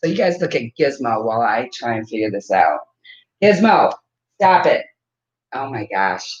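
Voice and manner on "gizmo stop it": sharp raised call at the dog; louder, telling the dog to quit; scolding the dog. Real, not acted. Owner to her dog biting the camera: raised scold.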